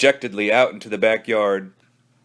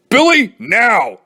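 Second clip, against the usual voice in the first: stern parent; 9 semitones higher, 6 dB louder, slower than usual. Acted. Parent orders Billy.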